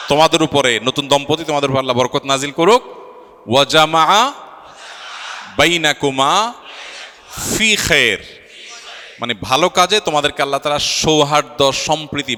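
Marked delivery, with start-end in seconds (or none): 3.5-8.2 slow, raised call with rising ends; leading a prayer. Real, not acted. Officiant leading all the guests in the blessing, raised call-and-repeat.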